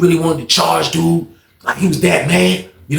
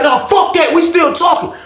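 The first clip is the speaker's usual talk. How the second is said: high, heated refusal; snapping; 11 semitones higher than usual. Retold or imitated. Rob refusing to let Skate leave, snapping at him. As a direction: act out cutting someone off in an argument. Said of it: "Rob and that junk snapping".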